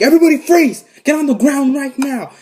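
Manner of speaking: high, loud mock command; shouted gunman's order. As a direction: shout it like an armed robber storming in. Gunman bursts in, retold.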